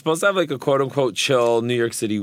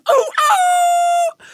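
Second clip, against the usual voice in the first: high squealing ooh; excited squeal; 4 dB louder than usual. Acted. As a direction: squeal it out with glee. Host excited intro.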